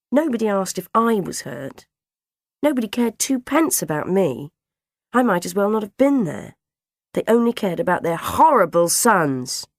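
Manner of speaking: high, loud indignant complaint; hurt, resentful protest; aggrieved. A narrator's reading. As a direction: complain as a neglected child. Narrating child feels ignored.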